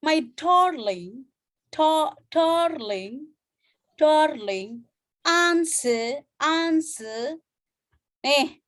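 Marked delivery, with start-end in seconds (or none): 0.0-7.4 "darling" and "answer" slowed and stretched with a long AH; drawn-out teaching read; slow demo. Shown as a demonstration. Teacher reading the phrase slowly to show the long vowel. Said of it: "yang paling terkenal adalah".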